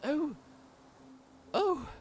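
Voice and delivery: high, flustered squeaky voice; a frazzled wood elf caught off guard; flustered shopkeeper. Acted. Wood elf shopkeeper flustered at a customer. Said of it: "she looks flustered as shit".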